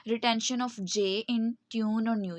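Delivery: normal talking voice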